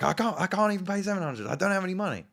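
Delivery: very high, loud whiny plea; loud high whine of having no money; mock desperate pleading. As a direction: plead poverty like a broke kid. Pleading poverty.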